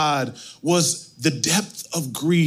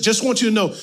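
usual speaking voice

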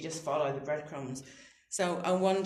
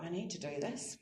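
inner thought; 8 dB quieter than usual. Retold or imitated. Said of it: "and I thought".

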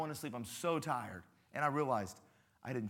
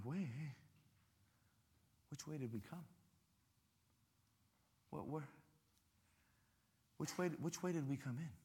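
much softer, anxious questioning; mild worried murmur; 8 dB quieter than usual. Retold or imitated. Hiker lost at a fork asks which way, quietly anxious. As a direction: wonder aloud, lost and unsure.

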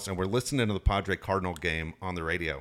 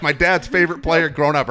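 ordinary delivery

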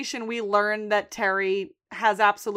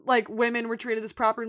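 ordinary talk

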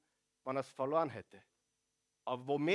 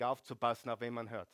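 ordinary delivery

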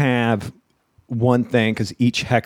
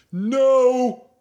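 heckler's no; 12 semitones higher than usual. Retold or imitated. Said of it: "One guy goes".

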